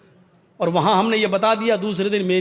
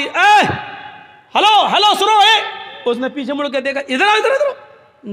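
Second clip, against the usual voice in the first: high, loud call after someone walking away; 8 semitones higher, 4 dB louder than usual. Retold or imitated. Umar calls the departing young man back, loud and high. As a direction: hail him back from a distance. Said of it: "اس کو آواز دی".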